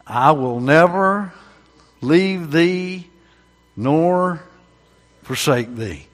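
each phrase slow and weighty, pauses between; slow emphatic recitation. Shown as a demonstration. Preacher starting a verse for the congregation, slow and emphatic. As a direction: lead the congregation word by word, slowly. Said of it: "If I start it, you'll finish it".

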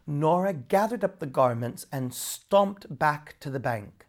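ordinary delivery

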